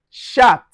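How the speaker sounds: "chat" begun with a sh sound. Shown as a demonstration. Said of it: "So we pronounce like".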